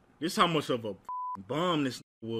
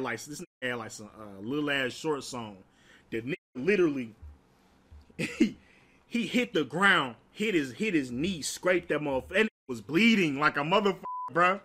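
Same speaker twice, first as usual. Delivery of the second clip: normal talking voice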